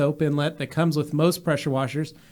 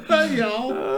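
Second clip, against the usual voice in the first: high Southern hey y'all through laughter; laughing Southern twang; 8 semitones higher than usual. Retold or imitated. Host echoing the twang joke, laughing mock Southern greeting. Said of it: "Southern twang".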